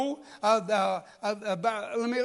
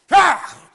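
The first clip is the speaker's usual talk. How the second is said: a sharp, loud startled "Ah!" shouted high; sudden angry outburst; 7 semitones higher, 13 dB louder than usual. Shown as a demonstration. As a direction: blurt out a loud angry yelp. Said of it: "that's how you respond".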